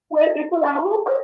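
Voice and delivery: rising distress. Real, not acted. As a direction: pitch climbs high, pleading and upset.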